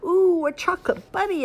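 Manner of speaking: put-on high, thrilled little boy's ooh; excited exclamation. Ian finding candy in his basket, thrilled. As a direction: squeal with delight at the treat. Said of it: "exclaimed Ian".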